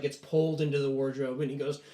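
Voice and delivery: ordinary talk, nothing marked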